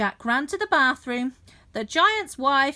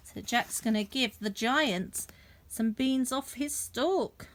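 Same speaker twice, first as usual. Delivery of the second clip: plain reading voice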